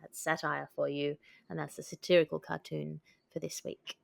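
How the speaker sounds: normal talking voice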